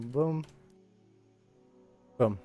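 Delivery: ordinary talk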